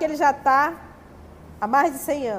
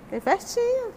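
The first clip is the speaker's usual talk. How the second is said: excited; 10 semitones higher than usual. Pretend. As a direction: squeal "festinha" up high, delighted. Repete a festa, mais animada. ("festinha" bem agudo e animado)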